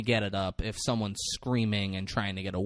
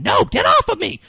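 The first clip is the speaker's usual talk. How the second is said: shrill high scream, much louder, pushing him off; 11 dB louder than usual. Retold or imitated. Beth screams at the man grabbing her, a high loud scream.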